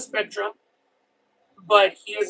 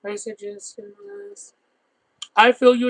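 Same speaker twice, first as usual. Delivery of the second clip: ordinary talk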